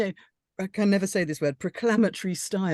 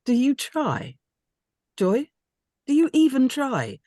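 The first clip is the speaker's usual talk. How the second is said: raised, rising frustration, pressing each question; exasperated accusation; 5 semitones higher, 4 dB louder than usual. Acted. Marla confronting Joy about the flowers, exasperated and louder. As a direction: push her hard, louder on the second ask.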